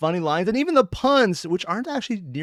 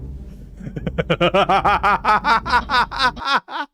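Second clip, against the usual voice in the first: long hearty laugh; 7 semitones higher than usual. Real, not acted. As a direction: burst out in a long laughing fit. Laughing at the video.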